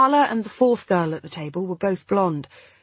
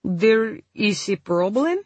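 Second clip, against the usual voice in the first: put-on slow, heavy Polish accent; 3 semitones higher, slower than usual. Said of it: "Ivona said in a heavy Polish accent".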